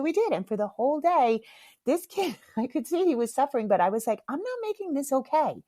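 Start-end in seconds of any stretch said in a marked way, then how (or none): 4.3-5.6 high-pitched retold refusal in one run; firm refusal. Mom refuses to soothe her guilty child, firm refusal. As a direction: raise the pitch and refuse to give in.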